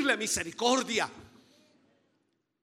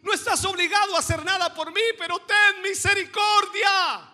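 crying out for mercy; 9 semitones higher, 8 dB louder than usual. Retold or imitated. The woman shouting to Jesus, loud begging cry. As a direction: beg at the top of the voice. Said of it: "Por eso ella gritaba y decía".

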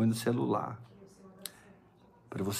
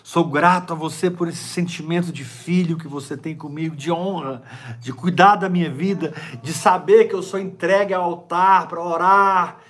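rising, louder preaching climax; fervent preaching; 7 semitones higher, 7 dB louder than usual. Acted. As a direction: build the sermon up, louder and higher. Preaching at full force. (pregação fervorosa, crescendo)

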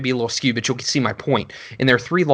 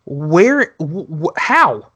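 incredulous; 3 semitones higher, 4 dB louder, much slower than usual. Real, not acted. Rant against Florida fans' excuses.

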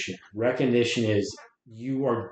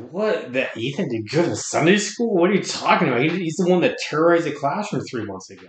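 high, disbelieving retort; mock disbelief at Ethan's praise; incredulous; 6 semitones higher, 3 dB louder than usual. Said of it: "I'm like".

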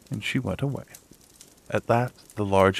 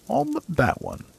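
put-on high, disdainful old duck; sniffy old duck. A narrator's reading. Grand old duck sneers at the ugly duckling, high sniffy voice. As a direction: look down your beak at him.

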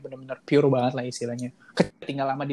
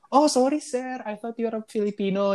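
mock apologetic driver; 6 semitones higher than usual. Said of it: "Terus dia bilang".

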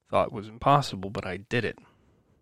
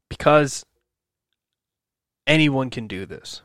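heated, raised-voice tirade; real angry determination, pushing louder; defiant rant; 4 semitones higher, 6 dB louder than usual. Real, not acted. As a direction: fire yourself up against the odds. Defiant rant about doubts and privilege.

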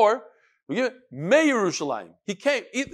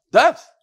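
death punched out loud and high after a pause; sudden loud death; 7 semitones higher, 11 dB louder than usual. Acted. Teacher springs the punchline on students, loud and sudden. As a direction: land the word death as a shocking punchline.

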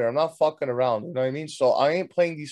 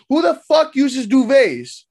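high, loud, slow indignant question; incredulous outrage; 8 semitones higher, 6 dB louder than usual. Acted. Ranting man scoffing at the woman's bedding, outraged. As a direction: demand it slowly, pitched up and loud. Comic rant at the absent woman.

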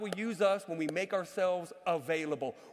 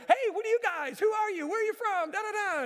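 pushy stranger; 12 semitones higher, 4 dB louder than usual. Retold or imitated. Stranger barging in, retold.

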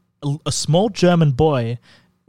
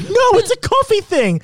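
high correction. Retold or imitated. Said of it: "I'm like".

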